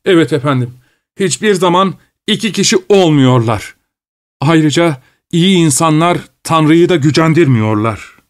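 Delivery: a hint of a grumbling manservant; Petruşka the servant. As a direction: protest like a sulky servant. Petruşka keeps taunting.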